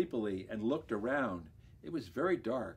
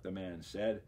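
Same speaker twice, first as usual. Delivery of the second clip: plain reading voice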